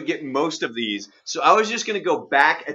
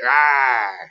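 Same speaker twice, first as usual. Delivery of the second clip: growl; 5 dB louder than usual. Acted. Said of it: "what is that?".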